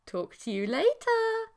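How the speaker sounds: put-on high chirpy girlfriend voice; sweet before the threat; sing-song creature girlfriend. A narrator's reading.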